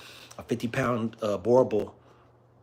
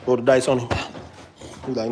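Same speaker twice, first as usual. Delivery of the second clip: normal talking voice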